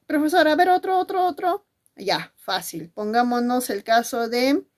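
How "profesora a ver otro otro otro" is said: high, pleading student voice, louder at first; eager pupil. Acted. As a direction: pester the teacher in a high pupil's voice.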